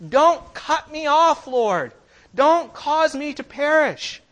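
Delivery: high, loud, drawn-out plea to God; pleading cry. Acted. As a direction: beg urgently, stretching the words out. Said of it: "a cry".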